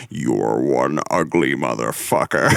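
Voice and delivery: deep, slow alien growl; low and slow like the Predator. Retold or imitated. Podcast host quoting the Predator's line, deep and slow. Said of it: "in his Predator voice".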